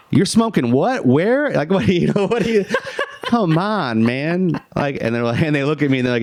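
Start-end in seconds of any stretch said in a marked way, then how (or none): 0.1-4.6 squeaky incredulous scolding with giggles; mock outrage. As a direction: ham up the shocked friend, laughing through it. Mock outrage at Trey, laughing.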